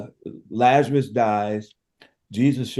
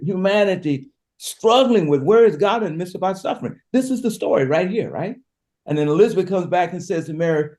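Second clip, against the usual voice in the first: usual speaking voice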